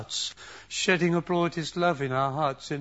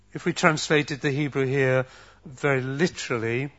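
ordinary talk, nothing marked

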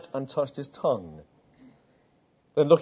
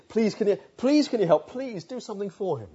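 urgent begging plea, higher; pleading friends; 6 semitones higher than usual. Acted. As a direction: beg Jesus to help their friend. Friends pleading for the deaf man.

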